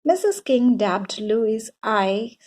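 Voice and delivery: plain reading voice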